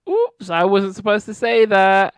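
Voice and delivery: high, loud singsong slip-up; mock oops. [name] letting the baby secret slip, mock oops.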